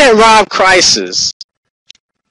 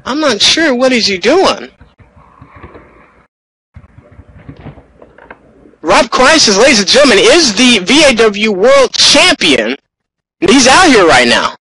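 urgent ringside commentary, a touch higher; live play-by-play; 6 semitones higher than usual. Acted. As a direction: call the action as it breaks.

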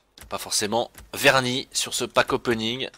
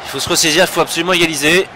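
excited sports commentary; 7 semitones higher, 7 dB louder, faster than usual. Real, not acted. Urging team to equalise.

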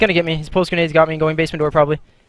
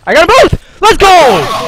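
loud high cheer of triumph; victory shout; 9 semitones higher, 8 dB louder, much slower than usual. Real, not acted. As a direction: yell it out after the winning kill. Winning the match.

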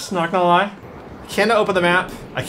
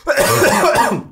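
gagging cough; 3 semitones higher, 4 dB louder than usual. Real, not acted. Gagging on pickled beets.

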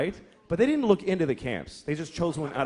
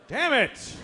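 high-pitched mock exasperation; mock angry griping; 9 semitones higher than usual. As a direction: curse in hammed-up frustration. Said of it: "People get angry".

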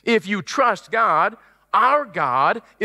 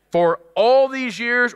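higher, louder, slow stretch of words; preaching emphasis; 5 semitones higher, 3 dB louder, slower than usual. Acted. Preacher stressing the years of faith, raised and slow. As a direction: lean in and lift the voice.

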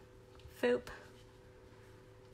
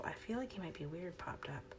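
soft whisper, much quieter; whispered musing; 3 semitones lower, 12 dB quieter than usual. Real, not acted. Crafter doubting the boat, whispered musing.

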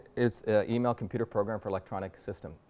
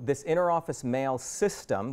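"system" stretched out slowly for emphasis; stress on system; 3 semitones higher, slower than usual. Shown as a demonstration. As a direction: lean hard and slow on the word "system". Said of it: "the emphasis on the word system".

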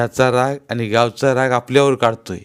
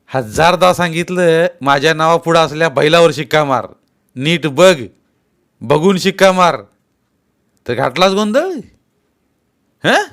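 angry scolding; 6 semitones higher, 7 dB louder than usual. Acted. Patil scolding his old mother, angry. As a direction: berate her for a careless mistake. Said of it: "रागारागानं बघत पाटील म्हणाले".

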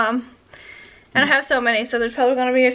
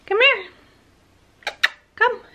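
much higher, coaxing call; high pet-calling voice; calling the puppy; 9 semitones higher than usual. Real, not acted. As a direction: call the dog over sweetly.

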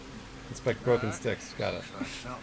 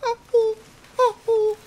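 high crying deer call; 6 dB louder than usual. Pretend. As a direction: cry out like a calling deer. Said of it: "I wanna do a deer call".

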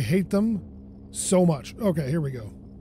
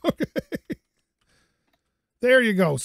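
ordinary talk

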